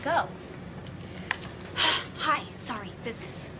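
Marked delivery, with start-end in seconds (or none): 0.3-2.1 long exhaled sigh. Acted. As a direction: let out a sigh after the call. Sighs after ending business call.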